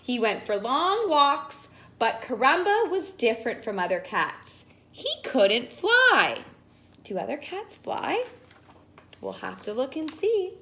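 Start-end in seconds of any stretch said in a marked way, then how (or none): none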